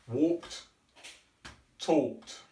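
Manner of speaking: walked, talked with the ed unstressed, one beat each; one syllable each. Shown as a demonstration. Teacher modelling the past tense for students, clipped one-syllable endings. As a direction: clip the ending to a plain t. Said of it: "the E D at the end is not stressed".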